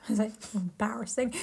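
hushed, whispered aside; whispered mock embarrassment. Friend joining in as Legolas among companions, whispered embarrassment. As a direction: whisper it as if mortified.

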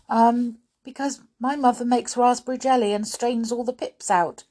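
high, hesitant explanation; nervous pupil. A narrator's reading. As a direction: justify yourself to a strict teacher. Voices Molly answering.

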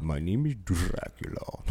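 put-on low, soft spooky Dracula accent; a lightly voiced vampire introduction. Friend says it sounds spooky, low soft Dracula. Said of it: "You sound spooky as fuck".

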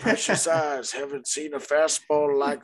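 put-on high creaky old-timer voice; cackling old geezer reminiscing; old prospector. Old-timer bit, high far voice.

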